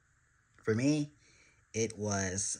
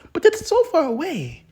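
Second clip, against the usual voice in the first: high, loud whiny complaint; loud whine; 12 dB louder than usual. Acted. Listener whining that Christmas is far away, high and loud. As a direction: whine like a child who can't wait.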